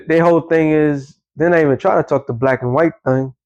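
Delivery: ordinary talk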